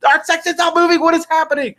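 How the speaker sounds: high, frantic disbelief; panicked. Retold or imitated. Fans stuck outside the stadium, high panicked squeal. Crowd panicking in stalled line.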